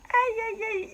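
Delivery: high cringing aïe aïe aïe; aïe aïe in French, gêné; embarrassed aïe aïe. Real, not acted. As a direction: wince at the memory out loud. Cringing at childhood memory.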